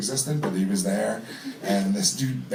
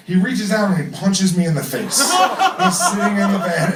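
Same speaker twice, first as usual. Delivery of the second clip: laughing through the punchline, half disbelieving; laughing disbelief; 6 semitones higher, 5 dB louder than usual. Real, not acted. Comic telling the crowd about the punch, laughing. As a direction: tell it to the crowd laughing at the absurdity.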